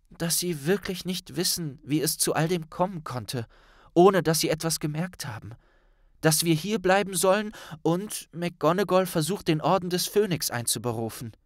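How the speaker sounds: a hint of a worried schoolgirl; young Hermine voice. A narrator's reading.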